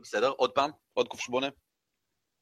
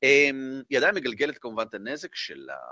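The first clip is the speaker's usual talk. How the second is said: ordinary talk, nothing marked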